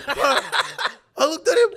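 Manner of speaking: laughing through the words, drawn out; can't hold the laugh while telling it. Real, not acted. Telling his mate the story, cracking up laughing.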